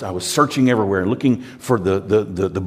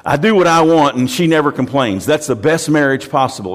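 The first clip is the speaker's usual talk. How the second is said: loud, higher mock of a smug husband; smug boast; 4 semitones higher, 4 dB louder than usual. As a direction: ham up the bragging friend. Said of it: "He says".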